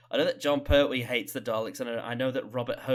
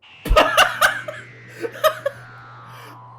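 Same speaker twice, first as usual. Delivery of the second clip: high, loud breathless cackle; hysterical laugh; 11 dB louder than usual. Real, not acted. Viewer cracking up at a line, wild laugh. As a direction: laugh till it hurts.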